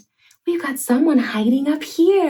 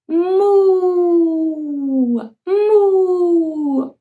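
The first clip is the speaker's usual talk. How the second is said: a higher, stretched moo to copy; cow moo; 5 semitones higher than usual. Shown as a demonstration. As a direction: moo big for the child. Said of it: "Can you moo like a cow?".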